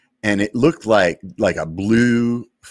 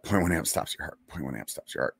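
inner mantra; 3 semitones lower, 10 dB quieter, faster than usual. Retold or imitated. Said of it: "And I remember, like".

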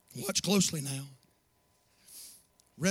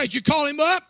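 higher, louder asking voice; mock questioning listener; 9 semitones higher, 9 dB louder than usual. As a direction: play the curious congregant.

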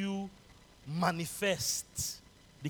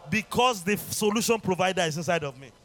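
loud fervent call and response; preaching; 4 semitones higher, 9 dB louder than usual. Acted. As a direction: drive the congregation to shout. Preaching at full force.

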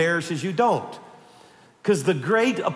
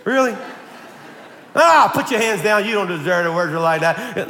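raised-voice, exasperated mock rebuke; mock scolding God; 2 semitones higher than usual. Preacher mimicking a wrongly imagined God, mock scolding. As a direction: act out an indignant God telling someone off. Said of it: "That's not God".

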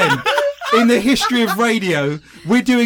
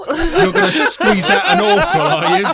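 high, laughing tease; giggling through a mock strain; 12 semitones higher, 4 dB louder than usual. Joking about straining, laughing.

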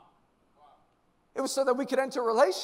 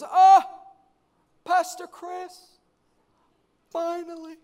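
high, drawn-out breathless-fan greeting; gushing fan; 8 semitones higher, 9 dB louder, slower than usual. Retold or imitated. Young lady finally meets her pastor, high gushing mock voice. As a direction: imitate an overexcited young woman. Acting out an eager admirer.

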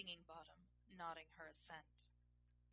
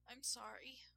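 high-pitched apologetic plea; pleading; 6 semitones higher than usual. A narrator's reading. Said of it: "she pleaded".

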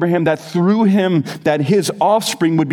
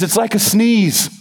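put-on higher-pitched, botched Australian accent; bad Australian accent; 6 semitones higher than usual. Preacher resuming the speaker's sneeze line, mock Australian accent. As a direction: have another go at a clumsy Australian accent. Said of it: "I'm butchering the Australian accent".

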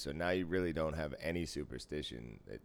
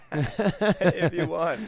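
high and loud through a laugh; 11 semitones higher, 8 dB louder, much slower than usual. Real, not acted. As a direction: still cracking up while offering. Laughing at friend's confusion.

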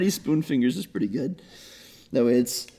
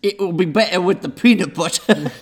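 put-on British accent; 4 semitones higher than usual. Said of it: "Brilliant British accents".

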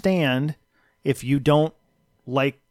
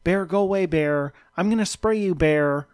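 mock scared kayaker; 5 semitones higher than usual. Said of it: "she's like".

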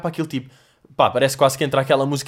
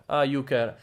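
ordinary talk

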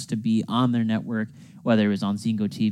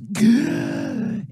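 dramatic gasp; 12 semitones higher than usual. Acted. Dramatic pause before name.

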